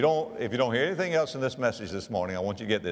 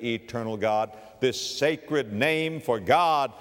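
ordinary delivery

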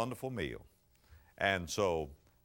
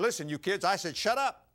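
higher and louder mock yell at the kids; father snapping; 9 semitones higher, 4 dB louder than usual. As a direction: blow up at the squabbling kids. Father loses temper at table.

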